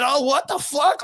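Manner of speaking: high, slow mock disbelief; the fighters react to the villain's reveal in mock disbelief; imitated stunned crowd. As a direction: play the crowd's dumbfounded gasp. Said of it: "And then".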